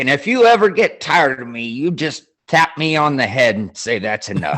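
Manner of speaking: retelling the sketch with a hint of a Southern colonel; Southern accent. Telling a friend about the sketch, lightly imitating a Southern accent. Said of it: "he's got that Southern accent".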